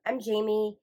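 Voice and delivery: ordinary talk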